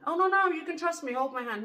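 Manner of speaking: high-pitched, insistent coaxing; pushy trickster. Retold or imitated. Trickster insisting the woman climbing the gate trust him, pushy. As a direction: brush off the refusal, high and pushy.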